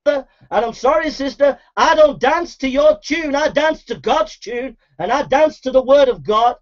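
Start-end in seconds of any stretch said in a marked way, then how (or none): none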